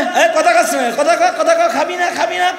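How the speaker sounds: bully the idols into speaking; laughing mock rebuke of the statues; scolding. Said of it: "মূর্তির যে ধমক দিতেছে".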